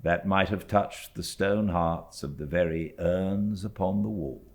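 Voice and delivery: measured, unhurried reading of a classic novel; story narration. A narrator's reading. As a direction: read it like a storyteller at the fireside. Reads novel chapter aloud.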